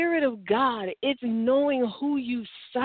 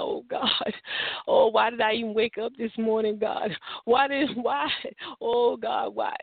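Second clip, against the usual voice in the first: mock tearful pleading, starting hushed then swelling; whining prayer. Believer whining to God about a bill due tomorrow, mock whiny. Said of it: "That's whining".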